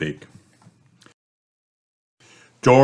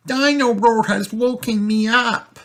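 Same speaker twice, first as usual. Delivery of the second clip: high-pitched whine on one note; cross, woken-up little girl; Peppa. Acted. Peppa woken, complains to brother.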